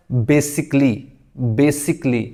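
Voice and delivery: "Basically" as BAY-sik-lee, falling; no a before the l in "Basically"; correct pronunciation. Shown as a demonstration. Teacher drills students, three-syllable "Basically" as basikly. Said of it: "इस ए को ख़त्म करेंगे".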